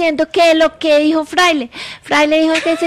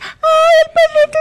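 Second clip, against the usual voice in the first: much higher, louder cooing; baby talk to dog; 12 semitones higher, 5 dB louder than usual. Retold or imitated. Co-host imitates him cooing at her dog, high squeal. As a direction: squeal it like doting on a puppy. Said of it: "si tú le dices".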